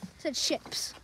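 whispered, said softly; whispered ships. Shown as a demonstration. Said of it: "I don't swear, I promise".

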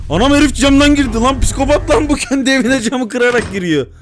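high, loud startled outcry; shocked shout. Real, not acted. Gamer shouts as the monster breaks in through the window. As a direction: yell in disbelief.

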